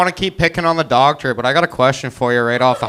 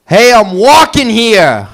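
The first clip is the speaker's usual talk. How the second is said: put-on loud, slow, higher New York accent yell; brash New York accent shout; New Yorker impression; 7 semitones higher, 9 dB louder, slower than usual. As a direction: belt it like a New Yorker on the street. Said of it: "my impression of a New Yorker".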